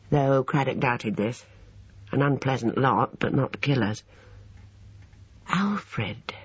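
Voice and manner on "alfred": softly musing, trailing right down; hushed, pondering murmur; speculative. A narrator's reading. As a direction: turn it over quietly to yourself. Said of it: "he repeated speculatively".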